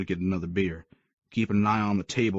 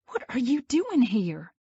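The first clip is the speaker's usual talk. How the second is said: put-on much higher, slow demanding girl's voice; annoyed young woman; slower than usual. A narrator's reading. Mia confronting Creed at the arcade, higher and annoyed. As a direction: press him for an answer as Mia. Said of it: "she questioned".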